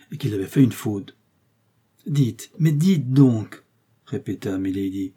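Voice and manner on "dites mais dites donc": higher, drawn-out urging; slow, raised-voice insistence; insistent demand. A narrator's reading. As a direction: press hard for an answer, stretching each word. Milady presses urgently for an answer.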